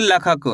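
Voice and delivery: लखक with a short "la" at the start; without e-matra. Shown as a demonstration. Teacher shows the word minus its matra, short first vowel in लखक. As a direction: say लखक, opening on "luh", no "ay" sound. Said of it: "अगर ला में ए की मात्रा नहीं होती".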